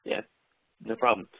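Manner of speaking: ordinary talk, nothing marked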